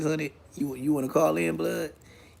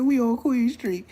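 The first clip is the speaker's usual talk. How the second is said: mocking quote; 8 semitones higher than usual. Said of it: "But he said".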